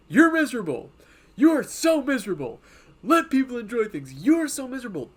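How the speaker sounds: high, loud mock accuser voice; mocking whiny scold. Mimicking Twitter people scolding others, mockingly loud and high. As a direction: caricature a preachy online scold, shrill and pushy. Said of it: "point the finger at other people and be like".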